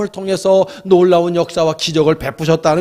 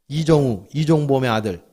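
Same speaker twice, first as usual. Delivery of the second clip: usual speaking voice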